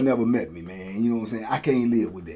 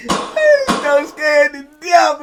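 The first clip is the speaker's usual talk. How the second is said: higher, slow, frightened voice; 12 semitones higher, 6 dB louder, much slower than usual. Real, not acted. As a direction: say it still shaken. Reliving the fear.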